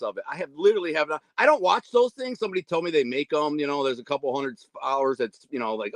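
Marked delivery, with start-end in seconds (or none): none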